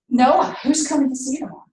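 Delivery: put-on fast, bright mother quizzing her toddler; rapid-fire mom. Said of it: "She's talking really fast".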